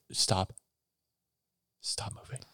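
hushed whispered command. Retold or imitated. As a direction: whisper it urgently. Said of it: "I was like".

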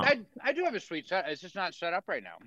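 talking with a mouthful of food; mouth full. Real, not acted. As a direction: speak through a bite of steak. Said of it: "Is your mouth full?".

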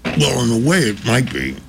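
talking through a mouthful of peanut butter; words muffled by a bite of food; mouth full. Real, not acted. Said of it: "don't talk with your mouth full".

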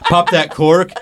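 high laughing celebration; excited whoop. Real, not acted. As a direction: cheer wildly, laughing. Celebrating retirement, shouting.

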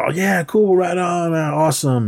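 high, eager oh yeah cool; excited relief. Retold or imitated. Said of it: "So Daniel's".

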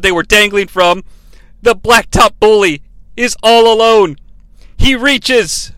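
excited wrestling commentator call; play-by-play commentary. Acted. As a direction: call it like live ringside.

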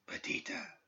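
a faint, breathy whisper of her name. A narrator's reading. Spirit calling Perdita from the dark, faint whisper. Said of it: "it whispered, so faintly".

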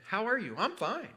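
high-pitched mock-cheery small talk; pretending all is well. Churchgoers greeting while life falls apart, pretend-cheery. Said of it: "We're pretending".

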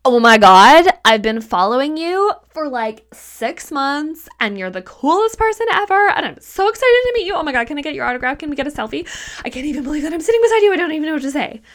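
high, loud, rushing gushing fan; overexcited fan. Retold or imitated. A fan meeting a celebrity, high rushing gush. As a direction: gush at them like a starstruck fan. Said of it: "As opposed to".

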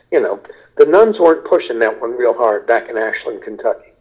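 normal talking voice

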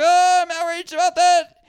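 high breathy parroting voice; mock outraged follower. Mocking everybody online, high fake outrage. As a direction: mimic a sheep repeating outrage. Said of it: "Everybody's like".

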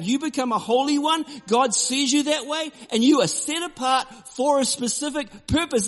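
high, loud, forceful preaching. Acted. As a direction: preach it out to the crowd with conviction. Preaching with raised voice.

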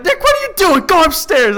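high, loud scolding mom imitation. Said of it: "my mom's like".

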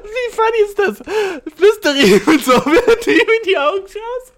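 very high, loud words through laughter; hysterical laughter. Real, not acted. Streamer rereading absurd advice, laughing hysterically. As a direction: squeal it out, helpless with laughing. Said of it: "Das ist hilarious".

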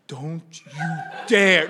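acted threat building to a loud last word; hammed-up parental warning; mock warning. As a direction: start held back, then hit the end loudly. Acted warning to daughter over food.

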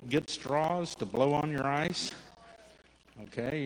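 usual speaking voice

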